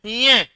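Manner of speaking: न्य pushed out through the nose; nasal sound. Shown as a demonstration. Teacher repeats the letter for students, nasal न्य. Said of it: "नाक में बोलो".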